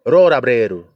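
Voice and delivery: rolabreru with a tapped r, said the Japanese way; Japanese pronunciation. Shown as a demonstration. Preacher demonstrating the Japanese word, said Japanese-style. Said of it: "the Romaji word for rollerblade in Japanese is".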